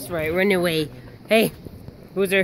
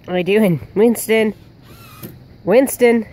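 loud call across the yard; 3 semitones higher, 4 dB louder, slower than usual. Real, not acted. Owner calling the dog from afar.

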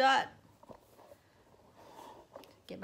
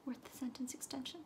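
whisper; 11 dB quieter than usual. Real, not acted. Whispered aside on set.

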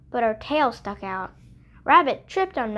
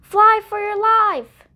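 higher, louder cry to flee; urgent warning; 10 semitones higher, 7 dB louder than usual. Acted. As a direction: shout the warning. Animals warn Baby Bug together.

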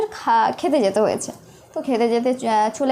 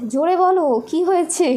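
raised, higher-pitched urging; retold urging; 5 semitones higher than usual. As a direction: imitate nurses calling out to her. Nurses retold urging her louder.

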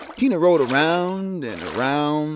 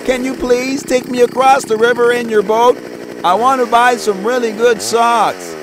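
put-on high, loud little girl pleading; a child begging her grandpa for a boat ride; voiced young girl, eager and high; Tina's voice; 8 semitones higher, 5 dB louder than usual. A narrator's reading.

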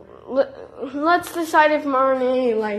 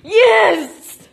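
triumphant yell of joy; excited cheer; 6 semitones higher, 3 dB louder than usual. Real, not acted. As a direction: cheer like you just nailed it. Celebrates landing the shot.

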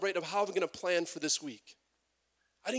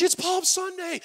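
"it's Palm Sunday" squealed much higher and louder; excited wife; 14 semitones higher, 7 dB louder than usual. Retold or imitated. Wife thrilled at hearing "it's Palm Sunday", much higher. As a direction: gush "Palm Sunday" with delight. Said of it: "she's all excited".

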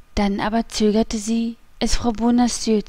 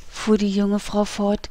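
plain reading voice

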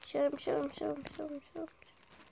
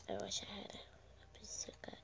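soft wistful whisper; 2 semitones lower, 15 dB quieter than usual. Real, not acted. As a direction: breathe it under your breath. Hiding, talking to self.

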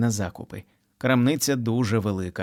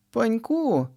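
mother calling; 12 semitones higher than usual. A narrator's reading. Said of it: "кричить вона".

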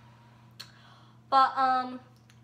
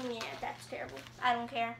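usual speaking voice

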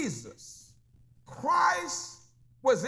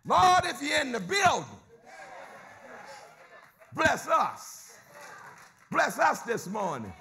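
preaching prayer; 2 semitones higher than usual. Acted. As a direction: cry out to the Lord for the church. Preacher prays aloud for blessing.

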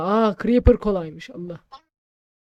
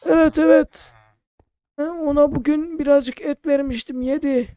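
put-on high loud old man voice; old shopkeeper voice; 7 semitones higher, 6 dB louder than usual. Player voicing shopkeeper Ahmet, high and loud. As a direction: answer like a hearty old uncle.

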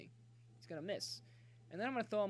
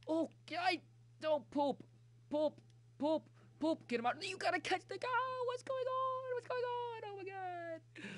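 high, loud panicked shouts rising into a wail; frantic yelling; 13 semitones higher, 4 dB louder than usual. Real, not acted. Gamer yelling at his fielder to catch it, frantic high wail. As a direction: yell in exasperated disbelief, far above usual pitch.

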